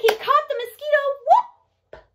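very high, loud burst ending in a whoop; excited catch. Acted. Showing children the catch overhead, excited catch.

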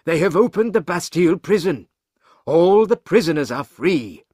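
story character breaking momentous news; urgent news-bearer. Acted. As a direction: deliver dramatic news with rising urgency. Banker reports the revolution in Paris.

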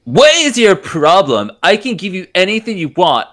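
ordinary talk

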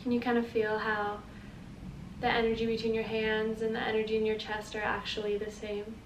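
soft, unhurried guiding voice; guided meditation. Acted. Teacher leading listeners through feeling their energy, guided meditation. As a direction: guide them gently through the exercise, leaving space. Leads breathing with the movement.

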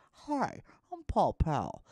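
light imitation of a commercial attorney; TV lawyer. Imitating a lawyer commercial, light ad voice. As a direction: greet like a TV ad. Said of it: "lawyer commercials".

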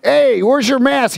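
high, slow mock challenge; mocking accuser. Stranger at the airport demanding a mask, high and slow. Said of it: "there's a guy there going".

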